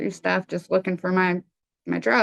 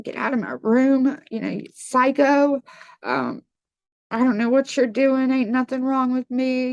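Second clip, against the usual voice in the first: angry cussing; 5 semitones higher than usual. Retold or imitated. Addict telling the helpful driver to get out, retold in a higher, heated yell. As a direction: throw the man out with a heated, raised voice. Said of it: "I began to cuss him".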